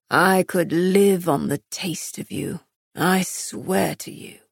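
low, slowed lover's voice, ardent; husky-toned romantic hero, savouring his words; seductive male lover. Acted. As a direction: swear devotion in a low male voice.

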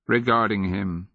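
usual speaking voice

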